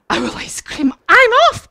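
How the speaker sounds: loud cry leaping up in pitch; hammed-up owl panic; alarmed owl. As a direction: shriek and flee, storybook style. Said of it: "Owl said".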